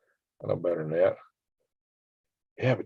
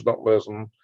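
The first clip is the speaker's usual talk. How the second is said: ordinary delivery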